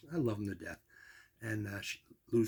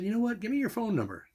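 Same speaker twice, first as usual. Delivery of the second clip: higher, excited friendly voice of Bob; eager Bob; 10 semitones higher than usual. Retold or imitated. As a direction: retell his eager request, pitched up. Bob eagerly asks for number.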